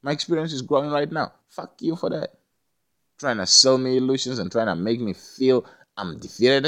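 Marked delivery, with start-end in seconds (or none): 0.0-2.4 fired-up retort. Retold or imitated. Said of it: "It's like".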